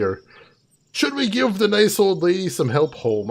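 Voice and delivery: ordinary talk, nothing marked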